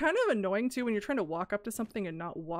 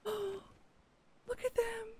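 high, soft and very slow, breathless with wonder; awed hush; 12 semitones higher, 7 dB quieter, much slower than usual. Real, not acted. She spots the orcas and gasps softly, high and slow. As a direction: let the words melt out in awe.

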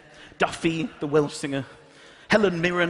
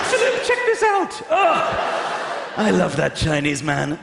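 put-on squeaky old queen gushing over the email; slower than usual. Voicing the Queen to Philip.

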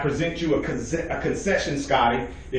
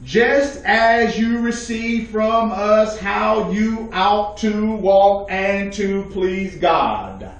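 very slow, drawn-out preacher's reading; emphatic scripture reading; 5 semitones higher, much slower than usual. Real, not acted. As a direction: read scripture out word by word, ringing. Reads scripture slowly and forcefully.

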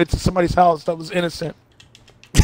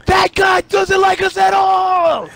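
[name] impression; 9 semitones higher, 5 dB louder than usual. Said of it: "[name] from [name] also".

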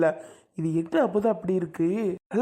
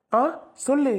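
ordinary delivery

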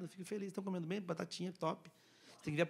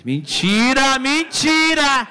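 loud protest; 5 semitones higher, 10 dB louder, much slower than usual. Real, not acted. Comic protest to crowd.

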